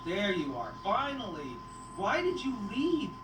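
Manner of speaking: usual speaking voice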